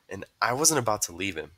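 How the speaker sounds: plain reading voice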